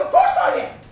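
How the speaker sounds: high, loud martyred rant; hammed-up mother shouting her complaints; aggrieved mother. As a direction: pile up grievances, voice climbing into a shriek. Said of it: "she said".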